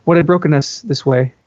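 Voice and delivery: ordinary delivery, nothing marked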